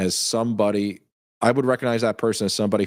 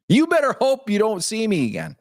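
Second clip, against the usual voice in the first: high, hammed-up tough-guy warning; mock threat; 11 semitones higher than usual. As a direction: playfully threaten him, pitched up. Mock threat to chat member.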